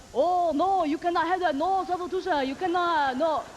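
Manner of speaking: jeering mock Chinese accent. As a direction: diners mocking the waitress's Chinese accent. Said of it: "They're like".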